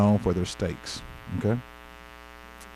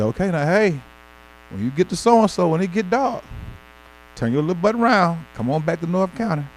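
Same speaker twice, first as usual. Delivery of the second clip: raised, urgent warning to turn back; like an elder telling you to get home before dark; 6 semitones higher than usual. Retold or imitated. People warning him on trips.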